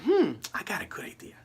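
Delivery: secretive whisper of a new idea; hushed scheming whisper. Retold or imitated. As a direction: breathe it like a secret plan. Tony quietly hatching a plan.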